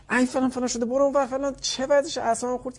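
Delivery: mother snapping at her child after hours of restraint, mock yelling; mock furious yell. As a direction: blow up at the child, high and sharp. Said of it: "برمیگرد تو روش".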